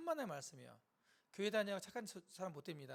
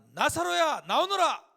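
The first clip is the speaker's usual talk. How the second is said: booming, high-pitched command; loud call; 9 semitones higher, 18 dB louder, slower than usual. Acted. Jesus calling Lazarus out of the tomb, loud shout. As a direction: summon with a full-force shout. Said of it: "큰 소리로 말합니다".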